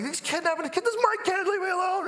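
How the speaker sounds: very high-pitched mock frantic yelp; hammed-up panicked dad defending himself; frantic protest. Dad rushing his crying kid out of the zoo, frantic. Running out with crying child, challenged.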